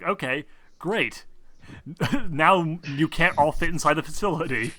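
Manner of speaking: usual speaking voice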